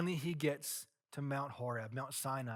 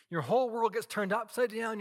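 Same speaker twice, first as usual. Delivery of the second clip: louder, higher, building urgency; rising intensity; 7 semitones higher, 6 dB louder than usual. Real, not acted. Preacher stirring the congregation, louder and higher. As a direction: let the world tip over in your voice.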